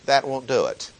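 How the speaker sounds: ordinary talk, nothing marked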